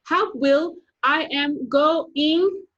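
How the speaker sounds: high, loud yell, slow stretched going; girl sliding off the curb, yelling. Acted. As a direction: scream it as she slides. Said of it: "yelling at the top of her lungs".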